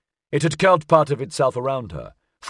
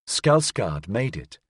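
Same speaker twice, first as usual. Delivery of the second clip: plain reading voice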